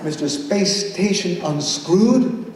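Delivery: big swoops up in pitch, stretched slow words, laughing; playful poem performance. Acted. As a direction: perform it like a giddy kids' rhyme.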